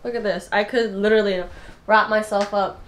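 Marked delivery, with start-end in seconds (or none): none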